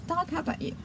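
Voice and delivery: usual speaking voice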